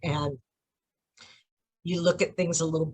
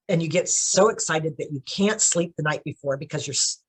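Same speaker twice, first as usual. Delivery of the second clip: usual speaking voice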